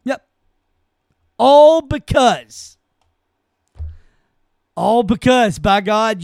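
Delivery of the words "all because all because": slow, loud, stretched "All because"; drawn-out outrage. Real, not acted. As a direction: lean hard on "All because", the first one very slow. Outraged emphasis on the cause.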